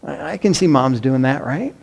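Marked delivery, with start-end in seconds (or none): none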